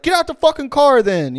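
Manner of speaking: high, loud dare to step out; a buddy daring the driver out of the car; retold challenge. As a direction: egg the guy on, half yelling.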